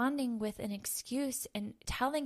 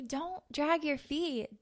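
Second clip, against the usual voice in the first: slower and higher, a measured order from God; God's firm command; 5 semitones higher, slower than usual. Acted. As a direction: spell it out slowly like a loving but firm command. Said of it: "he's telling you".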